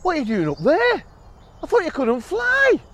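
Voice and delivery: high, bright-eyed bird calling down; a high little bird voice, surprised. Acted. Bird calls down to the mouse, high and surprised.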